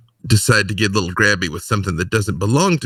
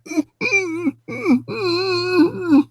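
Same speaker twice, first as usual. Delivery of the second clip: high choked whimper; frightened whimpering; whimpering cry. Acted. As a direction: sob pitifully through it. Tommy whimpers at Millie.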